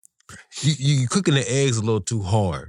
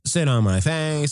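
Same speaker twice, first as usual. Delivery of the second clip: slow quote imitation; slower than usual. Repeating the quote slowly.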